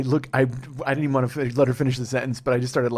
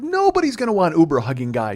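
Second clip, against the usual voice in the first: higher, louder dismissive protest; emphatic denial; 6 semitones higher, 5 dB louder than usual. Retold or imitated. Arguing with Jill over Uber hugging guy, emphatic denial. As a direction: shoot the idea down emphatically. Retold argument with partner.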